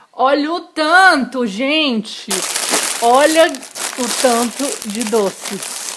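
loud, hyped show-and-tell; clickbait video narrator. Acted. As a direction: hype up the candy haul. Showing off candy haul.

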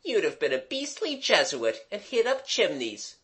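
lightly acted withering put-down. A narrator's reading. Jimmy insulting Mabel, withering. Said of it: "said Jimmy witheringly".

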